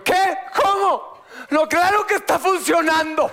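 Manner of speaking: high-pitched indignant protest; hammed-up, high outburst of denial; acted outburst. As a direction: play up frantic disbelief, pitch shooting up. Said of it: "Estoy actuando".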